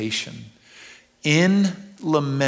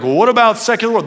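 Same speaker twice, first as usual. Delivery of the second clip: mock objection; 9 semitones higher, 8 dB louder, faster than usual. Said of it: "if you're like".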